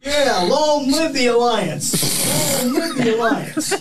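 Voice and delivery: slow, loud mock battle cry; rallying cry. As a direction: cheer it like a war chant.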